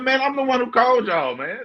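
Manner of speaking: high-pitched comic exasperation; mock indignant protest. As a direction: protest in a squeaky, put-upon voice. Retells his indignant protest to police.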